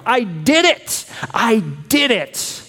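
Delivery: high, slow victory cry; triumphant cry. Retold or imitated. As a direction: call it out as if the fight is won. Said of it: "He yells out in triumph".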